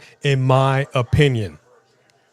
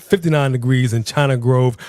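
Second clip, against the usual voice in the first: ordinary talk, nothing marked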